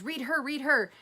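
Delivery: acted-out excited nudge; eager urging. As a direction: push eagerly for a friend's turn. Imitates girl urging a second reading.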